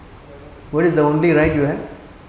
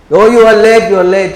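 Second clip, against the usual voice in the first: loud, high acted outburst; agitated reproach; 6 semitones higher, 12 dB louder than usual. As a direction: cry it out as a distraught son's reproach. Crying boy's reproach retold.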